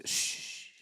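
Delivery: soft unvoiced shush; whispered shh. Real, not acted. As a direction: hush the crowd to listen. Hushing to hear the noise.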